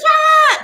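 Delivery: high yelled scream; streaking scream, high and loud; mock scream. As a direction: let out a sudden wild yell. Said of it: "running through the house like this".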